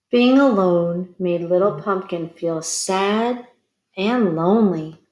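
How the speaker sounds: plain reading voice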